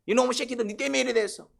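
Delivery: high-pitched acted scolding; mock accusation, pitched right up; resentful complaint. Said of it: "고통 중에 불평이 나와요".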